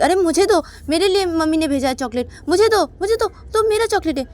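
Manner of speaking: very high, loud insistent claim; urgent childish demand. Acted. Payal demands her chocolate from the servant, high excited clamour. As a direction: clamour for it before anyone else gets it.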